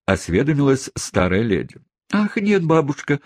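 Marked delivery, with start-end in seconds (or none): 2.1-3.2 put-on high-pitched young ladies' cry. A narrator's reading. Young ladies correct their grandmother, crying out high. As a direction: call out in a much higher voice. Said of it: "крикнули обе юные леди".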